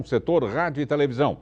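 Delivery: ordinary talk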